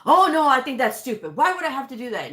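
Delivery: mock indignant protest, high and forceful; getting mad. Coder retelling a new doctor getting mad at her, mock angry. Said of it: "he starts getting mad".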